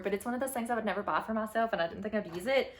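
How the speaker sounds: ordinary talk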